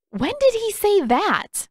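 can't believe what she's hearing; shocked and offended; indignant surprise. Acted. Katara hears of insult.

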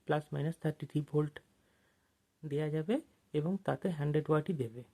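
ordinary delivery

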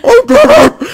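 very high, loud shriek dissolving into laughter; ecstatic screaming. Real, not acted. Player discovering the game saved them, ecstatic screaming. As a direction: scream with relief, nearly sobbing with laughter.

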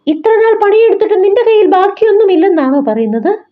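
can't believe she has saved nothing; surprised question. A narrator's reading. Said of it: "Ben അതിശയത്തോട് ചോദിച്ചു".

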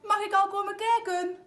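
high, loud call from another room. A narrator's reading. Mama asks from afar to come look, calling out. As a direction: call out eagerly to the child. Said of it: "roept mama".

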